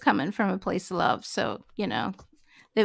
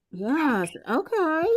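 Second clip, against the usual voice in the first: ordinary talk, nothing marked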